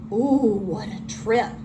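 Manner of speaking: ordinary delivery, nothing marked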